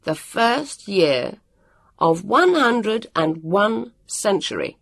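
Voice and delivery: high, emphatic, measured command; loud and slow. A narrator's reading. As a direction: spell it out word by word, loudly. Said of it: "he told it loudly and slowly".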